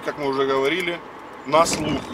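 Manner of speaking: usual speaking voice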